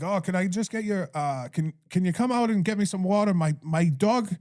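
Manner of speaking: high, hammed-up Irish brogue; a clumsy imitation of an Irish dog walker, pitched high; bad imitation of a man asking a woman at her door for water, high, in Irish brogue; bad Irish accent. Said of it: "I'm doing terrible at his accent".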